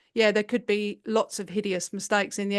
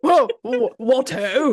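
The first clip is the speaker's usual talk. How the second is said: mock British surprise; 7 dB louder, slower than usual. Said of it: "mocking the British guy".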